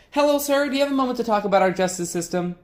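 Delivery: high, eager hail sliding into the pitch; chipper street canvasser. Acted. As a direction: greet a stranger in a high, upbeat canvasser voice. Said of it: "his constant chipper attitude".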